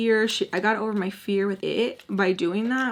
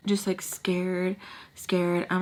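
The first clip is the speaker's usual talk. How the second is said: ordinary talk, nothing marked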